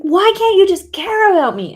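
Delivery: high, loud, pleading shout; hurt demand hurled at a partner; angry yelling. Retold or imitated. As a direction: throw the question out in anger. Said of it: "yelling that in my anger".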